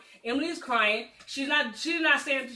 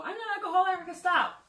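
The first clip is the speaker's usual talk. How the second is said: higher-pitched mock denial; defensive denial; 7 semitones higher than usual. Emily denies being alcoholic, defensively. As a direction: voice her brushing it off, higher.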